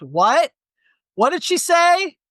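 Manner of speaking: high-pitched, puzzled interruption; imitated confused moviegoer. Mimicking old people who talk in movie theaters, confused moviegoer. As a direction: pipe up high like a baffled audience member.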